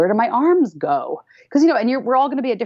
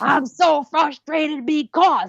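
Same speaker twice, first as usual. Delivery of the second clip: high, drawn-out cry of frustration; hammed-up venting, slow and high-pitched; embodied frustration; 8 semitones higher, much slower than usual. Shown as a demonstration. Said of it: "I have them embody it".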